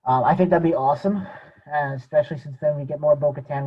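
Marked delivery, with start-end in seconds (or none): none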